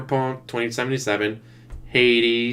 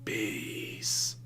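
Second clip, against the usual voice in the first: alien whisper; 14 dB quieter, much slower than usual. Retold or imitated. Mock alien voice again.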